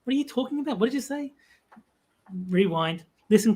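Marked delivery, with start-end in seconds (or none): 0.0-1.3 confused listener. Retold or imitated. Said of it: "it's like".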